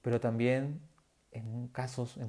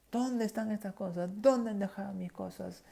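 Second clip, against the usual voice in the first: high, sharp mock yell of an angry boss; 8 semitones higher than usual. Preacher imitating his old boss yelling at her staff. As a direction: shout like the furious boss. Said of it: "lo que ella hacía era gritar".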